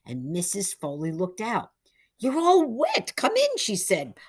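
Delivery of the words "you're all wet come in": put-on much higher, louder old lady voice; a startled old woman fussing. Old lady greets wet boys.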